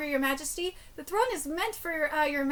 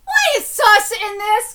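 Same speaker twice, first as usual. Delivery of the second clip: loud, slow, stretched complaint; exasperated yell; 5 semitones higher, 12 dB louder, slower than usual. Real, not acted. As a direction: throw up your hands and shout. Complains about the translation.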